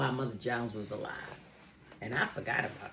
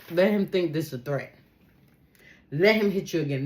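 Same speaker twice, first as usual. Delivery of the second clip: ordinary talk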